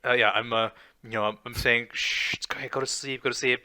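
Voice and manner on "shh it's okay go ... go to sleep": soft shushing whisper; whispering over a crib. Pretend. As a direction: hush a baby to sleep in a whisper. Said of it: "I'm singing you a lullaby".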